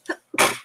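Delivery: a real sudden sneeze; sneezing. Real, not acted. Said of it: "I'm gonna sneeze".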